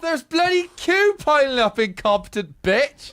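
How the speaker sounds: high, loud, slow shouted abuse; distant shouting. Acted. Officer shouting from a distance at the sergeant, loud. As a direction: yell it from across the hall.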